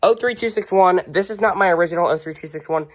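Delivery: ordinary talk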